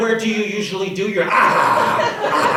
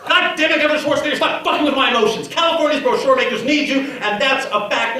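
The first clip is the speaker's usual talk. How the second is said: higher, louder furious yell; angry outburst; 8 semitones higher, 5 dB louder than usual. Acted. Hostess suddenly yells at the Governor in fury. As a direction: explode at him without warning.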